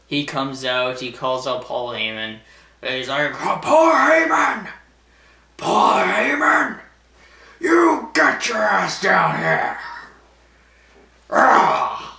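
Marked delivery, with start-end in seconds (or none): 3.3-9.8 high, loud, slow bellow for his rival; mock angry yell. Boss calling his rival to the ring, hammed-up yell. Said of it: "Like that". 11.3-12.1 mock roar. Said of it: "Like that".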